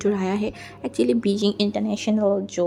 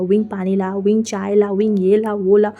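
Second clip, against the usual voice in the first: mock bossy uncle barking chores, louder each time; bossy orders; 3 semitones lower, 6 dB louder than usual. Wing mimicking uncle ordering him around, growing louder. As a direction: rattle off orders like a pushy elder.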